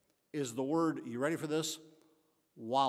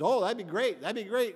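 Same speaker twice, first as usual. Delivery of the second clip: eager pig; 6 semitones higher, 8 dB louder than usual. Acted. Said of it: "And he says".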